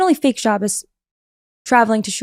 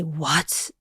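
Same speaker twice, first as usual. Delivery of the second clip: low, soft stunned what?; quiet what; 7 semitones lower, 10 dB quieter than usual. Retold or imitated. Seeing the meeting is on Zoom, hushed disbelief. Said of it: "And I say".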